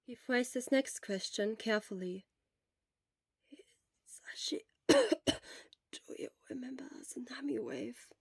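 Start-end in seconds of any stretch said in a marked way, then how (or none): 4.1-8.1 weak whisper; barely a breath, worn out; whispered from a sickbed. Acted. Sick Shura whispers throughout.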